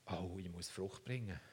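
low, soft worried thought voice; anxious inner thought. Retold or imitated. Believer voices inner fear of being cut off, hushed and low. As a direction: voice the believer's sudden hushed worry. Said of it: "was macht das mit mir?".

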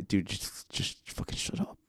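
whispered under the breath; hushed retort. Retold or imitated. Competitor answering the ref's nonsense, hushed retort.

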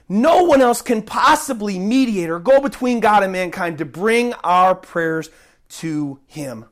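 ordinary delivery

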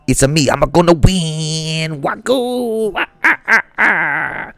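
Wario voice. Pretend. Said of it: "Wario the Shaman".

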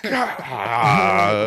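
high, loud comic wail of dismay; mock wail. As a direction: let out an exaggerated, laughing cry. Mock dismay at accent request.

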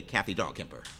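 ordinary talk, nothing marked

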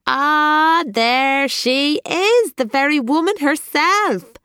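booming sing-song Joe. A narrator's reading. Café owner Joe greets Elizabeth, booming sing-song welcome. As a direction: sing it out big like a jolly shopkeeper. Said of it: "boomed the sing-song voice of Joe".

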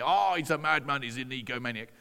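ordinary talk, nothing marked

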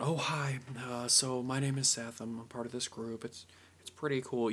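soft, timid self-introduction; eyes down, unsure. Acted. Same pitch to a business without confidence, soft and timid. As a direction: mumble it shyly with eyes down. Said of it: "sort of eyes down".

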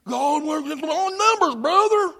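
high put-on voice, climbing at the end; mocking imitation. Preacher parodying a complacent brother, mocking imitation. Mimics an excuse-making believer.

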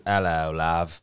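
low British greeting; brief mock-British hello; British accent. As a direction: put on a low-pitched British voice. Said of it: "The only thing I know how to say is".

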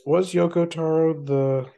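ordinary talk, nothing marked

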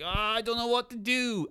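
high panicky wail; hammed-up panicking sailor; mock panic. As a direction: play it high and frantic. Panicked crewman.

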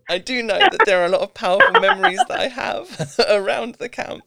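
laughing through the words. Real, not acted. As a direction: giggle as you speak. Laughing while telling memories.

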